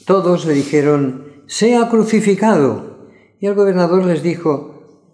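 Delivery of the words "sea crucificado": raised, slow crowd demand; crowd shouting. A narrator's reading. Crowd to Pilate, a slow raised shout. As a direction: shout it out for the whole mob.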